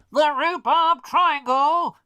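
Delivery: high, very loud, drawn-out proclamation; hammed-up mystery announcer; mock-dramatic boom. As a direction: boom out the dreaded name slowly. Booms the dreaded place name.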